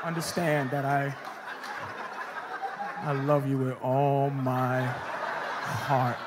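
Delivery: hammed-up tearful last words; mock tender farewell. Son saying goodbye to his hostage mother, slow and low.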